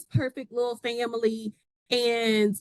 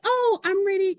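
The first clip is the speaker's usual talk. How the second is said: eager friend; 11 semitones higher, 4 dB louder than usual. Retold or imitated.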